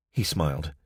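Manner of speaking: ordinary delivery, nothing marked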